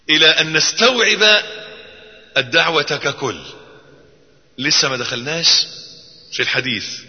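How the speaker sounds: ordinary talk, nothing marked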